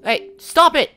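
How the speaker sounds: high, very loud yell; loud, high-pitched scolding; exasperated shout. Real, not acted. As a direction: shout a sharp telling-off. Yelling at the Xbox to stop.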